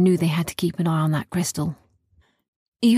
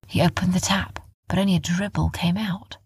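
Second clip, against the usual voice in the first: plain reading voice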